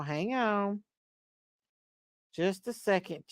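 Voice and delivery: ordinary talk, nothing marked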